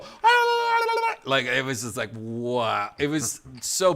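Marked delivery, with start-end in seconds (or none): 0.0-1.1 very high, loud babbling shriek; mock room-wide uproar. Host mimics everyone in the scammer's room, high frantic wailing. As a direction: imitate a whole room erupting in gibberish screams. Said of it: "everyone in the room just go".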